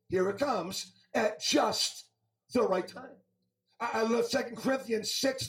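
measured preaching with weighted key words. Acted. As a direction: proclaim the scripture to a congregation. Preaches from scripture to congregation.

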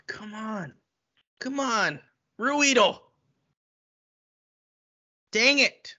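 high-pitched, lamenting groan; mild dismay. Pretend. Reacting to Ruhwedel's covid news, mildly anguished disappointment. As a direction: plead in vain, pitched high. Hears player is on covid list.

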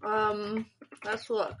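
chewing ice while talking; ice in mouth. Real, not acted. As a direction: talk around crunching ice. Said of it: "Sorry, I'm chewing ice".

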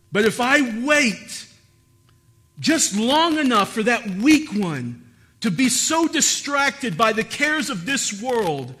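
high, loud preacher building in intensity; impassioned preaching. Acted. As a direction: preach it at rising full force, driving home each repetition. Preaches at full force as the lion.

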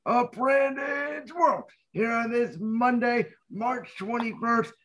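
booming ringmaster announcement. Acted. Host's grand show opening.

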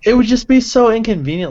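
high, loud exasperated whine; loud, high exasperation. Real, not acted. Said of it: "exasperated complaint".

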